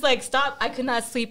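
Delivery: normal talking voice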